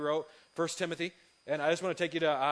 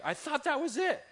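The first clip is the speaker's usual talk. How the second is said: much higher mock wife voice; 12 semitones higher, 3 dB louder than usual. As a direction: squeak it out as the fed-up wife. Voicing his exasperated wife.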